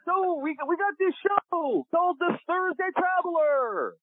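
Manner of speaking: very high, slow, sing-song impression of a TV producer; [name]'s voice. Producer pitching his show to Kevin on the phone, very high imitated voice. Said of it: "And he speaks like this".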